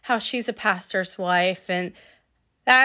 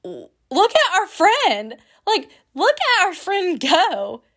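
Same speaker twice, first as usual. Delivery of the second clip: amazed admiration; 7 semitones higher than usual. Retold or imitated. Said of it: "We're just like".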